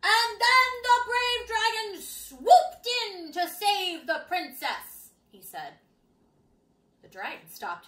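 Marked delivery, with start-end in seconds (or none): 0.0-5.1 loud, slow booming storyteller; booming reading. Acted. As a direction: boom it out to lure the dragon. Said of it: "read aloud in a booming voice".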